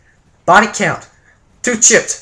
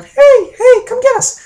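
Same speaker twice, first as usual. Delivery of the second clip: put-on high, loud little-kid shout for help; piping child calling across the snow; squeaky kid yell; 7 dB louder than usual. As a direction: yell like a nine-year-old whose voice hasn't dropped. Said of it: "We got squeaky voices".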